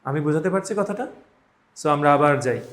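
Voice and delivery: ordinary talk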